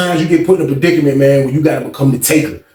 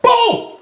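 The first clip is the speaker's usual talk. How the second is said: high-pitched vocal "boom"; punch sound effect. Retold or imitated. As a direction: fire it off like a landing punch. Imitates a punch landing.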